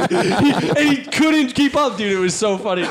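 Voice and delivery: normal talking voice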